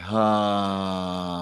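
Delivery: long relaxed audible sigh; sighing exhale. Shown as a demonstration. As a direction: let the breath out with a sigh. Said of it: "exhale with a sigh".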